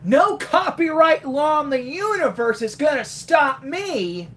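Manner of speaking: defiant Sonic boast; Roger Craig Smith Sonic. Retold or imitated. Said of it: "What's a good Roger line that I can do".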